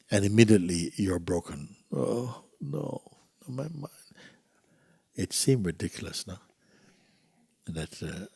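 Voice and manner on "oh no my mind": high, soft little lament; mock dismay. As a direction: whimper it in mock despair. Acts out being broken by voice.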